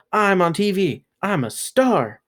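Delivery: high proud call, the last part slowed; shouted to the world. A narrator's reading. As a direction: announce it to the whole street. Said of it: "shouted to the world".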